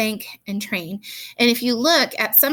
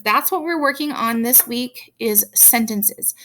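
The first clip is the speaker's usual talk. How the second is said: usual speaking voice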